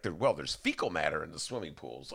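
low, soft, casual aside; offhand announcement. Retold or imitated. Imitating officials at a briefing, offhand. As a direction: drop it in matter-of-factly. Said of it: "they just kinda dropped that in".

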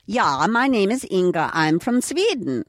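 hammed-up singsong Swedish accent; put-on Swedish accent.

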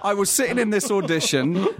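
high, laughing retelling; laughing while telling. Real, not acted. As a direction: laughs through the embarrassing story. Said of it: "sweating bullets".

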